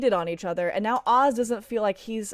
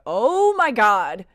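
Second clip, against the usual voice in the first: retold scream; 3 semitones higher, 8 dB louder, much slower than usual. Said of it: "I screamed".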